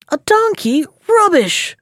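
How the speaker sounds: hammed-up outraged yelp at the insult; mock scream. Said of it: "screamed Simon".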